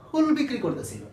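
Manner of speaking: ordinary talk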